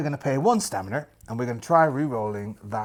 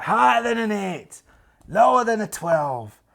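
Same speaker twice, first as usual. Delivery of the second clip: higher and louder showman calls; game show host call; 7 semitones higher, 6 dB louder than usual. Retold or imitated. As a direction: sell it like an eighties quiz host. Imitating Play Your Cards Right host.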